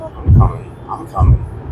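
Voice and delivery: slow creepy whisper. Pretend. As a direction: whisper it like a stalker. Mock whispered threat.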